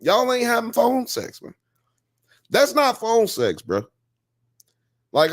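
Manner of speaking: high, scornful ranting; exasperated. Real, not acted. Host mocking the couple to chat, exasperated. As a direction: scold them in disbelief.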